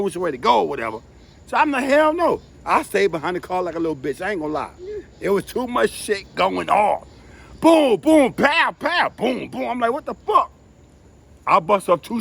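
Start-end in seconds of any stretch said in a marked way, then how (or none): 1.8-2.4 high, sharp hell no; alarmed refusal. Retold or imitated. Hiding behind the car, he refuses to run across, high and alarmed. 7.6-9.7 high, loud mock gunshots fading; gunfire noises. He acts out the shootout for the listener with mock gunshots. 9.9-10.5 high, startled what the fuck; shocked. Retold or imitated. Caught in the shootout, he reacts high and startled.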